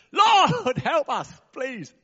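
sudden high, loud plea to the Lord; crying out to God. Real, not acted. Preacher bursts into a loud prayer to the Lord. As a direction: call out to heaven, then settle.

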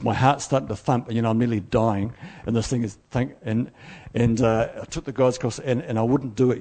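usual speaking voice

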